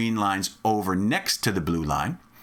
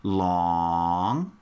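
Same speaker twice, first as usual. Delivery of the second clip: LONG held on a stretched, low vowel; long drawn out; 5 semitones lower than usual. Shown as a demonstration. Teacher showing the longer blue line, LONG drawn out. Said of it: "blue line's longer, so I have to say".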